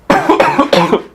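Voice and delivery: hard choking cough; coughing fit. Real, not acted. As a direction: cough after inhaling saliva. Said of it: "inhaled my own saliva".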